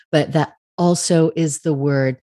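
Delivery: normal talking voice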